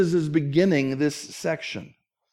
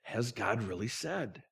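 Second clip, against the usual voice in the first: hushed, slow tempter's whisper, much softer; Satan's whisper; 6 semitones lower, 15 dB quieter, slower than usual. Retold or imitated. Preacher voicing Satan whispering doubt, hushed and slow. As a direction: breathe it like a serpent in the ear. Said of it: "Satan will whisper in our ear".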